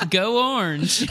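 high, loud fan chant; hammed-up team rooting; mock cheer. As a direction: cheer through laughter. Acting out cheering at game.

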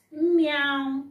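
high, loud mock meow; playful cat sound. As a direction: mew like a cat for the children. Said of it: "That one dey meow".

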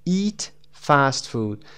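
normal talking voice

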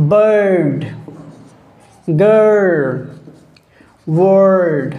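বার্ড, গার্ল, ওয়ার্ড with a long drawn-out "er" vowel; long touchy আ. Shown as a demonstration. Teacher giving examples of long touchy আ, vowel held long. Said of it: "লম্বাভাবে touch করে যেতে হবে".

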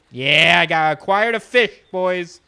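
loud victory announcement; triumphant cheer. Real, not acted. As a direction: crow loudly over the win. Celebrates getting the fish.

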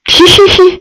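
loud high nervous laugh. Real, not acted. Laugh at scare. (lautes hohes Lachen)

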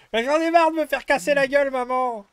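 put-on high, loud kid's complaint; whining child. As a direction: whine to mom like a beaten kid. Son complaining to mother.

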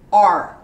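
a plain R sound, as in the letter; "R" said alone, crisp. Shown as a demonstration. Teacher sounding out the R for students. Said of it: "It's like the letter R".

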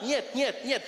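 choppy Russian-sounding gibberish; mock Russian, backwards vinyl. Comedian showing how Russian sounds, choppy backwards-vinyl gibberish. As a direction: babble Russian like a record played backwards. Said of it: "sounds like someone's playing a vinyl backwards".

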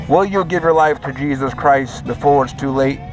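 normal talking voice

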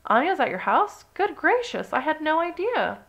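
high-pitched astonishment on the phone; shocked mother, voice way up; astonished. A narrator's reading. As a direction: react to startling news, pitch soaring.

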